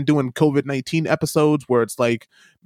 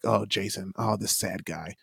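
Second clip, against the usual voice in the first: mock pity; 6 semitones lower, 10 dB quieter, slower than usual. Mock sympathy from viewers.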